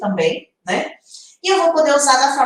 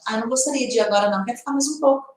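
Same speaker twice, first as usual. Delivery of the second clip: quick casual aside; 4 dB quieter, much faster than usual. Retold or imitated. Casual everyday version of phrase.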